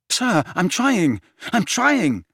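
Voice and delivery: insist, desperate. A narrator's reading. Harry desperately telling Dumbledore he is trying. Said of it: "said Harry desperately".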